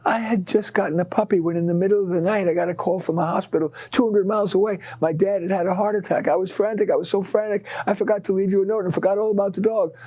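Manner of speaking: frantic neighbor. Retold or imitated. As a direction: rush the apology, pitched well up. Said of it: "She said".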